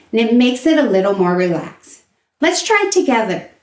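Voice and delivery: ordinary talk, nothing marked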